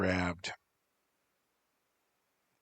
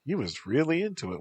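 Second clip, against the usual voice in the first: ordinary delivery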